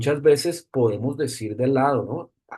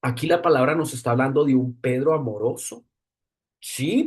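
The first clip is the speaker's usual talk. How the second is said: ordinary talk, nothing marked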